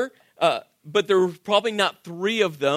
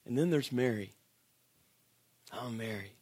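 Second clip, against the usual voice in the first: hushed reflective preaching; 7 semitones lower, 6 dB quieter than usual. Acted. Pastor to the congregation, hushed and reflective about Mary. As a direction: draw them in quietly, letting each question land. Quiet reflective sermon passage.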